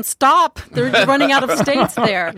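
loud, high exasperated outcry; mock scream. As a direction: cry out as if begging someone to stop. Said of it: "wanting to scream at him".

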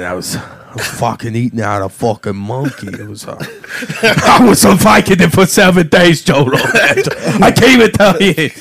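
loud, rambling Joey Diaz bit; Joey Diaz impression. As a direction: do a rowdy Joey Diaz story. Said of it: "So Joe Rogan".

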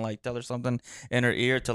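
normal talking voice

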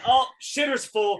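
retold yell. Telling friends about Cousin Eddie's drunk moment, mock yell. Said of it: "and is yelling".

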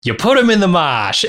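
a light Boston accent, mimicking the director, stress landing on "mosh"; lightly imitated Boston director giving orders; retold Boston accent. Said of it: "you have to say with a Boston accent".

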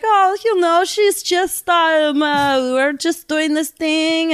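high, sing-song fake Scandinavian accent; badly faked Scandinavian-Minnesotan counselor, drawn out; bizarre Scandinavian accent. Teen posing as counselor to dad, bizarre Scandinavian accent. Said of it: "Scandinavian mixed with".

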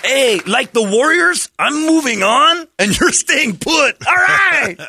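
high, loud, slow teasing voice that ends in a shout; teasing a friend in a waiting room, high hammed-up gloat; mock gloating taunt. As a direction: rub it in like a cocky player. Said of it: "I'm going".

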